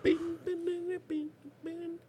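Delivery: high beeps dying away very softly; fading beeps. Retold or imitated. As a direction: keep beeping, fainter and fainter. Beeping fades under the other speaker.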